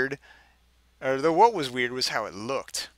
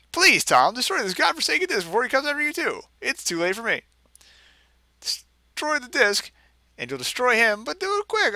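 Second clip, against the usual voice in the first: high, hammed-up desperate begging; frantic plea; 9 semitones higher than usual. Reader voices Kyle begging Tom, hammed-up panic. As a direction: beg him to destroy the disc. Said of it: "this is what he wrote".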